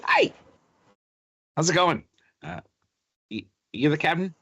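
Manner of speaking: high, quick chirpy gnome. Acted. Gnome greeting the new captain, high and chirpy. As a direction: pipe up like an excited little gnome. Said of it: "looks up and goes".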